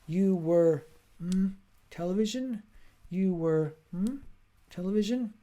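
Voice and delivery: "You were" held, hummed gap, then "television" high and questioning; fill-in-the-blank prompt. Retold or imitated. Teacher prompting student to guess the blank, "mm" hummed between "You were" and "television". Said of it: "one teacher did it this way".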